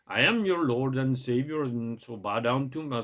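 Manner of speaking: Jesus line in a put-on Indian accent. Jesus demanding the crowd bow, in a mock Indian accent. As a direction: try for Arabian, land on Indian. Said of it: "So that's an Indian accent, not an Arabian accent".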